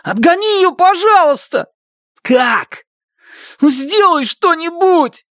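high desperate begging; near hysteria. Acted. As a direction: plead on the edge of hysteria. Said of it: "изо всех сил стараясь не впасть в полноценную истерику".

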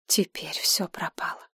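hollow inner whisper; despairing whisper. A narrator's reading. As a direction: whisper it, all hope gone. Galina's despairing thought. (шёпот отчаяния)

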